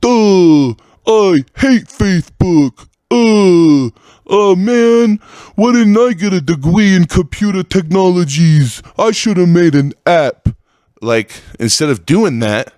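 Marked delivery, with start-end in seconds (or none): 0.0-10.5 much higher, louder whining caricature; whiny mock complaint. Podcaster mocks Californians who complain instead of leaving, loud whiny voice. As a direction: whine like a spoiled local who missed out. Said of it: "whining and going".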